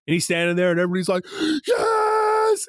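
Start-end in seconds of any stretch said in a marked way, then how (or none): 1.7-2.7 very high, drawn-out mock squeal; imitated crowd cheer. Imitating the theater as he comes through, high ecstatic cheer.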